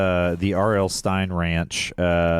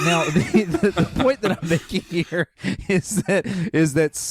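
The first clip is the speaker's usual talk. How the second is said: higher-pitched, laughing through the words; 8 semitones higher than usual. Real, not acted. Host derailed by co-host's teasing, laughing. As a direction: push on with the point while laughing.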